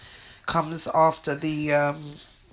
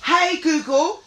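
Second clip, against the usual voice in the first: repeating to voice assistant; 9 semitones higher than usual. Real, not acted. Asking Google, raised pitch.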